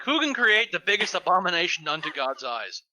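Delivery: normal talking voice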